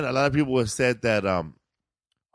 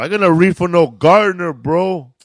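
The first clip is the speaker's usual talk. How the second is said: loud, higher, indignant protest; Willie Barcena; 6 semitones higher, 9 dB louder than usual. Retold or imitated. Imitating a fellow performer refusing a role, loud indignant voice. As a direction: belt it out as an offended refusal. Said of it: "I remember Willie Barcena".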